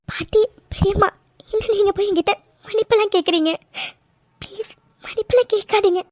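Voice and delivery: high, tearful protest; crying. Acted. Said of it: "அழாதடா, அழாத". (அழுதபடி கெஞ்சும் குரல்)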